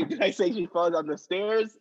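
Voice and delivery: ordinary talk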